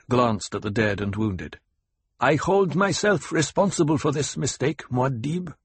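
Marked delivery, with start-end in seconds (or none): 2.2-5.5 higher-pitched formal admission; lieutenant owning up. A narrator's reading. A lieutenant takes blame for the mistake, higher-voiced admission. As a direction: own the blame before your leader.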